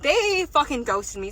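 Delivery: ordinary talk, nothing marked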